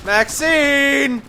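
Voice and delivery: exasperated call. Acted. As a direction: call out in reproach, high and drawn out. Scolding companion for getting involved.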